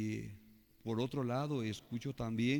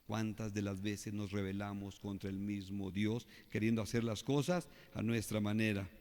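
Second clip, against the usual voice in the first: normal talking voice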